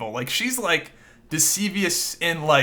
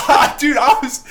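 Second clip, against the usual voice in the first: laughing; 10 semitones higher, 8 dB louder than usual. Real, not acted. Laughing after chant.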